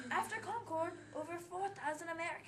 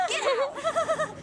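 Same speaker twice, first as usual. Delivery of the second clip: mock shout; 11 semitones higher, 11 dB louder than usual. Colonist gives in, then shouts.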